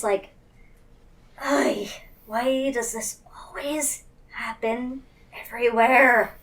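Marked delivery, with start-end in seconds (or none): none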